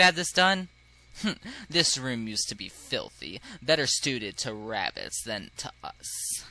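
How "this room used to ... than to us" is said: low, slow, lofty snob; low-voiced haughty noble; haughty aristocrat. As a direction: sneer softly down at everyone in a low drawl.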